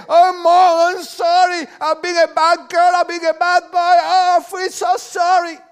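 put-on sarcastic sobbing-child parody in English; mock tearful apology. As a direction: ham up a guilty child's belated sorry. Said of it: "venir a llorar y decir".

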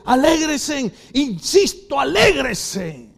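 high, loud preacher's command; emphatic exhortation. Acted. Pastor voices Paul urging believers, emphatic loud exhortation. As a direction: proclaim it, stretching the last word.

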